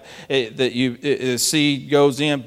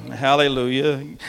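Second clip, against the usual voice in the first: usual speaking voice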